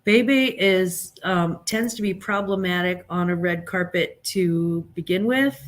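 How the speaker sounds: ordinary talk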